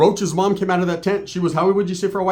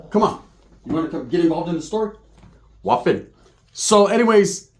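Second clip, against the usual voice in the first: ordinary talk, nothing marked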